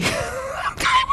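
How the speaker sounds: high giggly voice through a laugh; laughing aside. Real, not acted. Preacher laughing at the scary story, high and giggly. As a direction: laugh it off, squeaky and amused.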